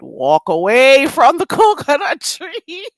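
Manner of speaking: slow, loud, rising pitch, breaking into laughter; drawn-out first take. Real, not acted. Streamer warning off accent critics, drawn-out and laughing. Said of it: "should I say it in a, another way".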